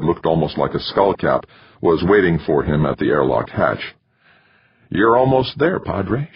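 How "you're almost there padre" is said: put-on high, drawling West Texas accent; a female astronaut with a West Texas twang. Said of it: "in a West Texas twang".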